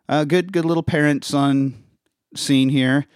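normal talking voice

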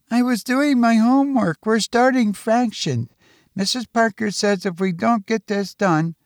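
put-on high-pitched child's voice; protesting schoolboy. Son explains his fractions homework to parents, high and protesting.

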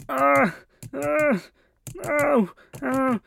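mock yelps of pain from next door; pained cries. Roommate in the next room, imitated pained cries. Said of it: "all I heard was".

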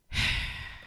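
heavy, breathy sigh; emotional sigh. Real, not acted. To listeners, too moved to speak, sighing. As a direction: sigh as the feeling wells up. Overcome with gratitude at the end.

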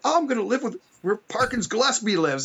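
retold excited reaction. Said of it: "and I'm like".

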